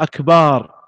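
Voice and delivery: أكبار with a long drawn-out aa after the b; lengthened baa vowel. Shown as a demonstration. Teacher showing a faulty adhan word, second vowel lengthened. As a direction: stretch the second syllable into أكبااار. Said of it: "أو يمد الباء في أكبر".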